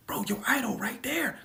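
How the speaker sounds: urgent whisper to a friend. Retold or imitated. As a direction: hiss it so nobody hears. Friends nudging him at event.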